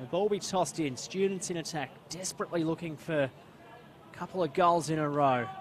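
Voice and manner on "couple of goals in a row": ordinary delivery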